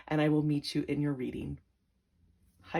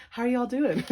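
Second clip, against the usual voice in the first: lightly put-on Southern accent, higher; Southern greeting; 6 semitones higher, 4 dB louder than usual. To the viewers, a mock Southern accent greeting. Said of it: "I almost started saying".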